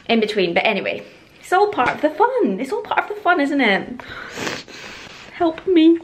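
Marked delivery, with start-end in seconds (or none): 2.6-4.0 much higher, sing-song forced cheer; mock cheerful. Repeats phrase, second sung high. 5.3-6.0 higher, louder comic cry for help; mock plea. Joking despair over heavy bags.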